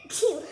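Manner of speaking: loud real sneeze; sharp sudden achoo; sneezing. Real, not acted. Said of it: "I'm gonna sneeze".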